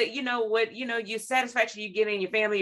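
ordinary talk

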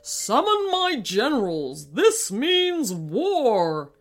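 booming warrior king. Acted. King declares war.

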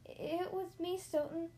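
small, high squeaky confession; high-pitched squeak. A narrator's reading. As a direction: pipe up in a high voice. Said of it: "a familiar voice squeaked".

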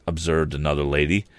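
ordinary delivery

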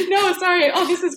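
high laughing apology, breaking up. Real, not acted. Guest laughing at the hosts' mishearing, then apologizing. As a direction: laugh it off, caught out by the mix-up.

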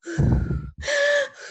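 put-on sharp, high in-breath like a toddler showing how; imitating a child's exaggerated deep breath; mock dramatic inhale; big deep gasp. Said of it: "take a deep breath. Watch me".

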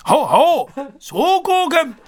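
high, slow shouted attack name; special move shout. Shown as a demonstration. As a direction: bellow the super move like a game fighter. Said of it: "イントネーションね。".

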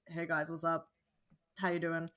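low, quiet, laid-back greeting; deep, subdued hello. Acted. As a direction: drop the voice low and soft. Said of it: "all my friends talk like this".

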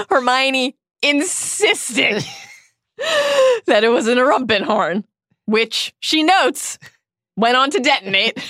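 words broken up by laughter; laughing while talking. Real, not acted. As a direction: laugh through it, voice high. Laughing through the retelling.

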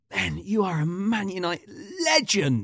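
mock a starstruck fan gushing at a player; imitating an enthusiastic fan. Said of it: "this guy comes up so enthusiastic".

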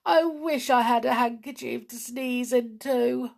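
high, loud, woeful complaint; doleful. A narrator's reading. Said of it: "said Perry dolefully".

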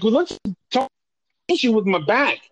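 high-pitched, exasperated plea; flustered. Retold or imitated. Patient pleading to discuss his back, flustered. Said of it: "getting a little more flustered".

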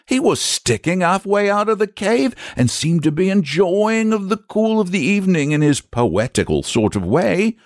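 high-pitched rustic, full of wonder and unease; fretful country storyteller; troubled shepherd. Acted. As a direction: describe the beast as a rattled shepherd.

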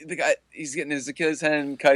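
ordinary talk

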